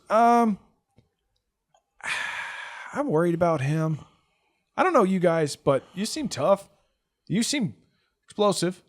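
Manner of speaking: put-on high boy voice with a sigh; worried kid. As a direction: flatter them nervously. Kid worried, flatters bomber.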